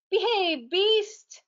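high, loud heroic battle cry; rally like a hero charging in. Acted. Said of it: "let's try some battle cries".

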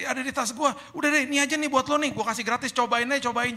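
high, loud, pushy sales pitch, slow at the start; eager seller. Retold or imitated. Friend who sells mouthwash urging the wife to take it, eager loud pitch. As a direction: press the free sample on her, beaming.

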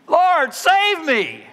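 high, drawn-out cry for rescue; desperate scream. Acted. Preacher as Peter sinking in the water, screaming desperately. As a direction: scream it out slowly, pitch way up. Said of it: "It can even be translated scream".

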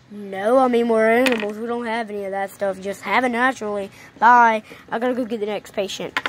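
low, bored doctor brushing off his patient; gruff doctor voice. Acted. Doctor telling a pregnant patient to step on the scale, low and bored. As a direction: drop low and play the unbothered vet.

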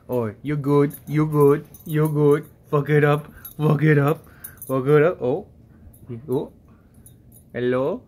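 usual speaking voice

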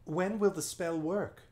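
put-on high, eager girl's question; young girl voice. A narrator's reading. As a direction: ask like a curious little girl.

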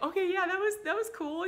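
put-on high, loud little kid voice agreeing; reassured child voice. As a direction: play a toddler won over. Child reassured after scare.

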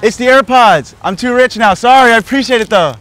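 high-pitched loud call-out; loud mock bragging. Joker answering a woman about dropped garbage, loud mock bragging. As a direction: shout a cocky apology across the lot. Playing rich, calling to bystander.

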